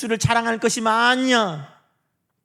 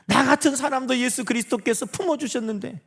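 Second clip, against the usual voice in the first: normal talking voice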